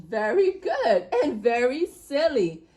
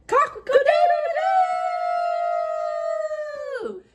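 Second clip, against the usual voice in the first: rooster crow; much slower than usual. Shown as a demonstration. Host ends the show crowing like a rooster. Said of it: "the final farm animal is a rooster".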